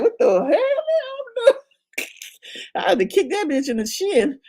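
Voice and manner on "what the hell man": very high, slow, drawn-out disbelief; hammed-up high squeal of surprise; mock shocked exclamation. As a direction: stretch it out in high astonishment. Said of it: "I'm like".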